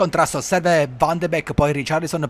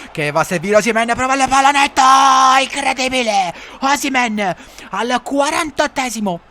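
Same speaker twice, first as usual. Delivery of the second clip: higher, louder excited goal call; breathless italian goal commentary; 9 semitones higher, 4 dB louder than usual. Acted.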